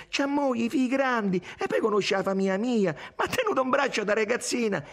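high-pitched, insistent self-defence; exclaiming. A narrator's reading. Irene lists why the nephew is no rival, exclaiming. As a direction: reel off objections in a high protest. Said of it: "esclamò Irene".

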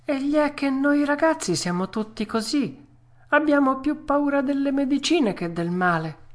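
put-on high-pitched boy puppet; Pinocchio, sheepishly candid. Puppet excusing himself to the fairy, high and candid. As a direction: explain yourself in a high child's voice.

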